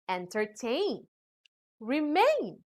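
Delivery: "entertain, remain" landing hard on TAIN and MAIN; last-syllable stress. Shown as a demonstration. Teacher giving examples of ending ain, "entertain, remain" stressed at the end. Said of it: "usually carry stress on the last syllable".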